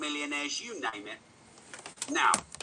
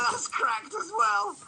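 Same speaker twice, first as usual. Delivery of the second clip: high-pitched aside; slower than usual. Real, not acted. Showing his cracked phone.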